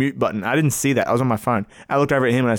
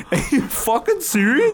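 mocking echo; 10 semitones higher than usual. Mocking friend's outburst.

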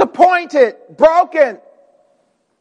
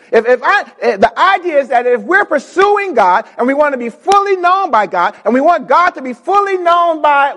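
ordinary talk, nothing marked

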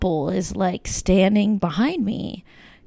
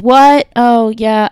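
shouting over club music; 3 semitones higher, 9 dB louder, slower than usual. Retold or imitated. Talking in a loud club.

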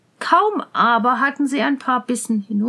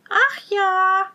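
put-on high, mournful girl's voice; a sorrowful, higher-pitched maiden; sad voice; 7 semitones higher than usual. A narrator's reading. Girl answering the prince's plea for lodging, sad. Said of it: "mit trauriger Stimme".

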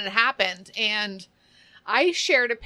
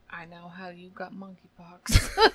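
whispered meme quote; 5 semitones lower, 4 dB quieter, slower than usual. Retold or imitated. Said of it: "a little dog with a glass of wine".

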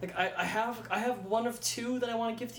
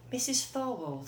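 put-on slow, higher, clipped Missus Thorwald in old Hollywood style; transatlantic accent; 4 semitones higher, slower than usual. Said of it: "it's like".

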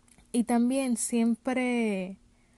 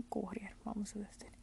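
whispered aside; 14 dB quieter than usual. Real, not acted. Whispered aside about the job.

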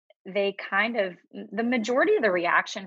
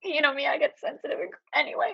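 crying; 7 semitones higher, faster than usual. Real, not acted. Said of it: "I just start crying".

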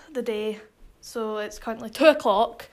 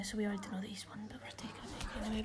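whispering; 3 semitones lower, 17 dB quieter, much faster than usual. Real, not acted. Aside kept from family.

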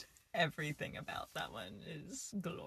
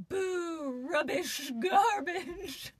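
mock heckling; 9 semitones higher, much slower than usual. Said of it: "the yelling in the background".

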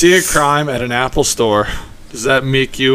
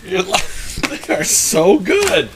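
laughing; 7 semitones higher than usual. Real, not acted. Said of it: "just laughing at your own".

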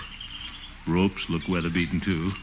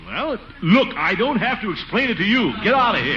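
high indignant bluster; 13 semitones higher than usual. Acted. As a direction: shoo the kid off. Scolding nephew.

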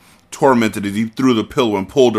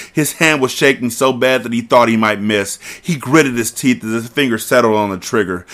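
usual speaking voice

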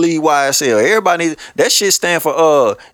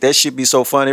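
ordinary talk, nothing marked

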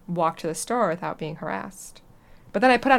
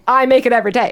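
higher and louder, fed up and insistent; exasperated retort; 4 semitones higher, 11 dB louder, faster than usual. Retold or imitated. Retelling how she snapped back at the mansplaining date, exasperated. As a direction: push back hard, annoyed he won't listen.

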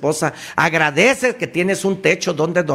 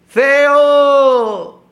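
loud, much higher yell of Feo; shouted at the hills; 12 semitones higher, 6 dB louder than usual. Shown as a demonstration. Shouting into an empty house to hear the echo, loud yell. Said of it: "llega a una casa sola y grítale".

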